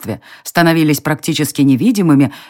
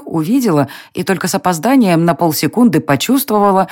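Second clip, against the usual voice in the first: ordinary delivery, nothing marked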